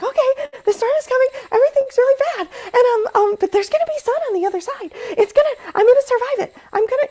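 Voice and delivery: very high, falsetto mock cheerfulness; mocking, very high sing-song voice of someone bypassing their feelings. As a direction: parody a forced-positive self-talk, squeaky and high. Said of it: "yeah, that's bypass".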